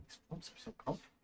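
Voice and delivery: fast, unvoiced whisper. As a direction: breathe it out fast and hushed. Whispered aside.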